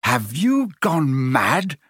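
slow, stretched-out mock bellow; a restrained exasperated bellow. A narrator's reading. Ron to panicking Hermione, bellowing with restraint. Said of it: "Ron bellowed".

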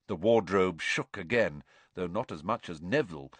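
usual speaking voice

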